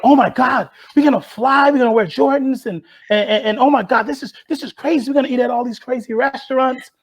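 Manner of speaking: high, thrilled squeal of disbelief; gushing like an overjoyed kid; excited. Retold or imitated. Teen rowers dreaming of Jordans, gushing high and excited. Teens thrilled about promised perks.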